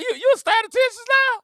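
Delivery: high, mocking question; teasing disbelief. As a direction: needle them about being statisticians. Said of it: "I'm like".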